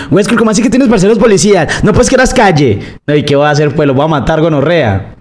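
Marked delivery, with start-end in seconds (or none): 0.1-2.8 put-on sneering teen voice pitched way up, rushed; whiny kid. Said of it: "no falta el niño". (imitando a un niño burlón con voz aguda y rápida)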